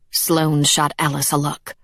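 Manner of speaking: ordinary delivery, nothing marked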